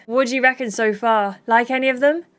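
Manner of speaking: normal talking voice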